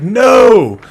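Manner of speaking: loud high shouted no; mock-outraged dad shout; disbelieving no.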